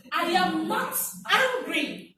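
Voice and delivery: loud, slow, laughing denial; a teacher's demo of an angry denial; said angrily. Shown as a demonstration. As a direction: insist you're not angry while clearly angry. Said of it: "Because my tone, my body, everything about me".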